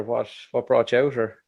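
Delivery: ordinary talk, nothing marked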